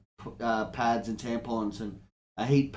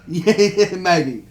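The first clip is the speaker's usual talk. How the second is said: louder, higher laughing concession; giggling, admitting it half-heartedly; 5 semitones higher, 10 dB louder, much slower than usual. Real, not acted. Laughs at being called out.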